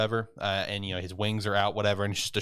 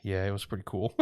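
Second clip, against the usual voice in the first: understated, grudging approval; softer, holding the feeling back; reluctant, downbeat; 2 semitones lower, 5 dB quieter than usual. Retold or imitated. Depressed boy answering the eager angel, downbeat. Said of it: "he's horribly depressed".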